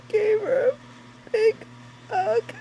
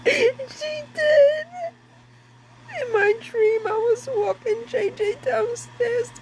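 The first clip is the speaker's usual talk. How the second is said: tearful and trembling, pushing words through sobs; slow, higher, choked with tears; crying; 3 semitones higher than usual. Real, not acted. Grieving mother telling of her daughter's visit, crying.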